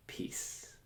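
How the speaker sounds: whispered, barely voiced; silent inner word. Shown as a demonstration. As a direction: whisper it as if only thinking it. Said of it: "think the word peace silently to yourself".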